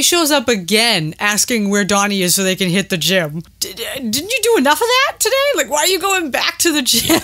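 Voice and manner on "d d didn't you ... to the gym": exasperated. Retold or imitated. Mock exasperated question to character.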